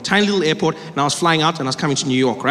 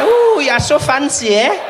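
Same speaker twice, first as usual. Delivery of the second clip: high mock-impressed coo; a hammed-up impressed lady, drawn out and laughing; impressed woman; 9 semitones higher, 4 dB louder, slower than usual. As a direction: swoon at the fancy man.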